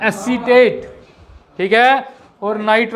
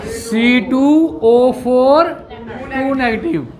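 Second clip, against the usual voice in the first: slow dictation; much slower than usual. Shown as a demonstration. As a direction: spell out each symbol slowly. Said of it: "अगर ऐसे नहीं लिखना तो आप ऐसे लिख सकते हैं". (धीरे-धीरे लिखवाते हुए)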